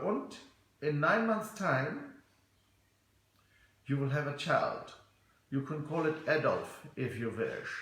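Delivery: put-on measured comic German accent. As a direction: joke German, pausing between phrases. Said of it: "this is German oak".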